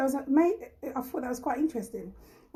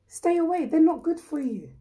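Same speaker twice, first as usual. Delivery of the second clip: emphatic warning; 5 semitones higher, 3 dB louder than usual. Real, not acted. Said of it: "repeats warning".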